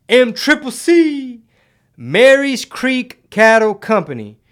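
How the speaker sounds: slow, high, loud country drawl; west texas twang. Pretend. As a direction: stretch it out like a Texas cattle ad. Drawn-out sponsor name announcement.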